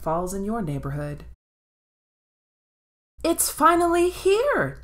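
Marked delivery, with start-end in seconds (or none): 3.2-4.8 high, slow, thrilled cry; excited yell. A narrator's reading. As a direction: shout the news with joy. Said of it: "Ginger yells to her mommy".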